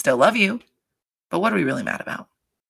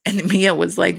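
normal talking voice

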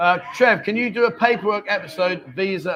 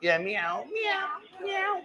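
high meowing, softer; cat meows; 11 semitones higher, 7 dB quieter, much slower than usual. Shown as a demonstration. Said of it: "animal impressions".

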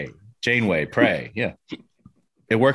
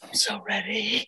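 eager breathy whisper; 11 semitones higher, 8 dB quieter than usual. Real, not acted. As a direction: whisper it excitedly. Eager whispered reply before limerick.

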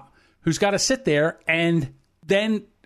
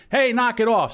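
raised-pitch command to stop; mock police order; 6 semitones higher than usual. State police arrive at a fight, mildly shouted order. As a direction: imitate a cop breaking up a fight.

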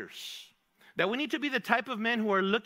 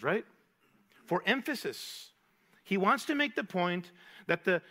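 ordinary talk, nothing marked

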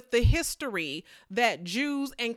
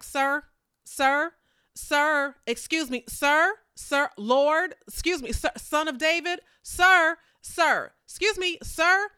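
rising, insistent calls of sir, getting louder; a woman nagging to be noticed, calling sir louder and higher; pestering call; 5 semitones higher, 4 dB louder than usual. Retold or imitated. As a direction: keep pestering him louder each time.

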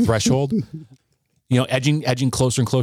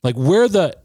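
drawn-out, higher-pitched demand on each 'where'; indignant questioning; 7 semitones higher, 3 dB louder, slower than usual. Retold or imitated. Son confronting his mother over her absence, indignant questioning. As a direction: challenge them, voice leaping up in exasperation. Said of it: "saying, like, out loud".

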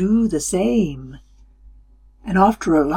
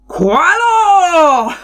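big, high, loud laughing call; booming voice; 10 semitones higher, 6 dB louder than usual. A narrator's reading. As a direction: boom it out with a laugh. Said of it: "A voice boomed".

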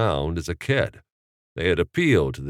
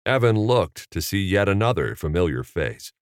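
plain reading voice

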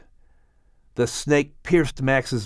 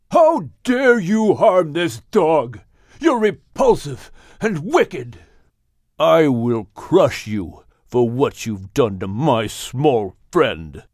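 screaming; 2 semitones higher, slower than usual. Acted. Shem raging at the snake that hurt Max, slow furious scream. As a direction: spit out a slow furious threat. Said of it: "Shem screamed at the snake".

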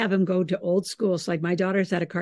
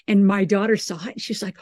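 ordinary talk

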